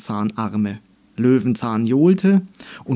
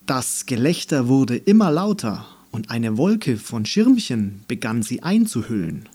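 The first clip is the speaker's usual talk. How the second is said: ordinary delivery, nothing marked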